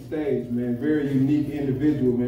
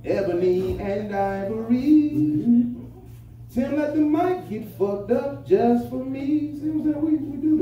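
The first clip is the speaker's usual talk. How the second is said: high hyped-up voice; 9 semitones higher, slower than usual. Real, not acted. Hype talk to sound guy.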